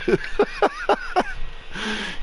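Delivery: a sudden high squeal of a laugh. Real, not acted. Laughing at his clueless friends, a high squealing laugh.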